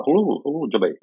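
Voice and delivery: high-pitched put-on Scottish greeting; Scottish accent. Shown as a demonstration. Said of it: "I can still do the Scottish accent".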